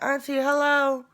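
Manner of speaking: high, loud cheerful hello; eager high phone greeting; bright greeting. Retold or imitated. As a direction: call it out brightly to your aunt.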